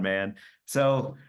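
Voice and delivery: ordinary delivery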